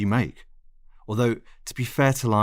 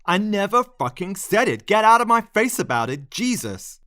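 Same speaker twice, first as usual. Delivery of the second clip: much higher, loud furious yelling; explosive shout; 12 semitones higher, 6 dB louder than usual. Acted. Lionel exploding at the narrator in the bookshop, furious shout. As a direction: blow up at her in public. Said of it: "he exploded".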